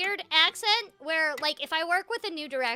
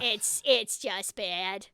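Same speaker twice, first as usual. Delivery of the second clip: slow, lower, Midwest vowel on "bad"; Midwest accent; 6 semitones lower, much slower than usual. Real, not acted. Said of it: "it just came out right there with bad".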